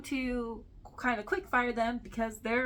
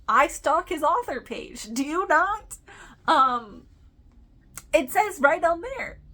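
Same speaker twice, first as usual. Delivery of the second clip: higher and louder playful comeback; hammed-up matter-of-fact defence; mock defensive retort; 7 semitones higher, 9 dB louder than usual. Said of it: "And I'm like".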